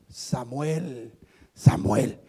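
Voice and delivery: very low, subdued call; voice of God calling. Acted. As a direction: call the name low and unhurried. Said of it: "le habló nuevamente la voz".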